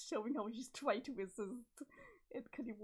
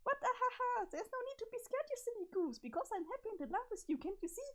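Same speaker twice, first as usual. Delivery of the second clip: high, giggly and unsettlingly sweet; high-pitched creepy cheer; high manic Jane; 11 semitones higher, faster than usual. Acted. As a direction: soothe him while sounding unhinged. Jane reassures frightened Jake.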